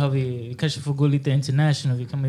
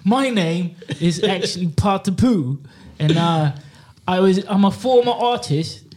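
lightly put-on London street accent; higher, laughing London slang voice; london roadman accent; 5 semitones higher than usual. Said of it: "Me, I'ma use this, what's this guy".

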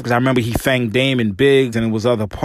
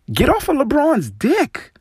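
"Get off of LeBron's dick" much higher, with a sharp rise on "off"; angrier repeat. Real, not acted. Host repeating his demand to the commentator, angrier. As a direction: repeat it, pitched up in pure exasperation.

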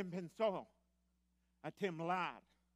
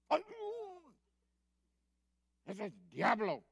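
alarmed cry; 4 semitones higher, 6 dB louder than usual. Acted. Acting out alarm at the devil.

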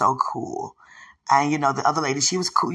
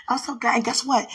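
normal talking voice

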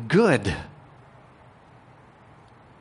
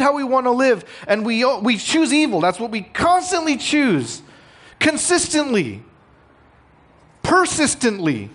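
full-force preaching; 13 semitones higher, 5 dB louder than usual. Acted. Pastor proclaims to his congregation, preaching at full force.

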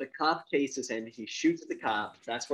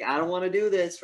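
higher, louder reluctant protest; mock plea; 6 semitones higher, 6 dB louder than usual. Cop resists the duel, mildly put-on higher plea. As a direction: lightly act out a man pleading.

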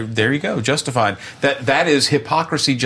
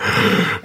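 obligatory gasp; 2 semitones lower than usual. Pretend. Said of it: "My, my obli-, my obligatory gasp".

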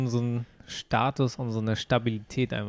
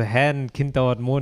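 ordinary talk

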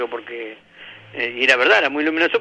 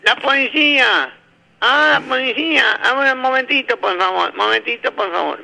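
high, slow nasal-sounding speech; nasal kid voice; 9 semitones higher than usual. Retold or imitated. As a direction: play the nasal boy answering the door. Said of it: "el nene gangoso".

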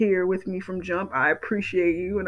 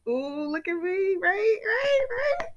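high squealing show-off voice, preening; mock diva brag; 13 semitones higher, slower than usual. Showing off her podcast stats to listeners, high diva squeal. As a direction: squeal like a diva admiring herself.

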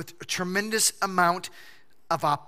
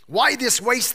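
indignant; 6 semitones higher, 6 dB louder than usual. Acted. Disciples' indignant quote.

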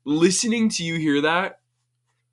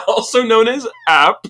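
laughing; 10 semitones higher, 4 dB louder than usual. Real, not acted. Laughing at the joke.

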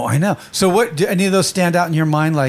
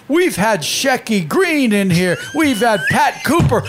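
raised, drawn-out mock heckle; mock ribbing; 6 semitones higher, slower than usual. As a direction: imitate someone needling a comic, higher and slow. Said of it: "like, ribbing him".

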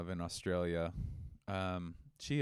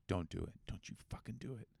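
hushed, softer warning; mock hissed warning, much softer; urgent hushed warning; 9 dB quieter than usual. Fiancé warns partner not to get in the truck, hushed. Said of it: "And I go".

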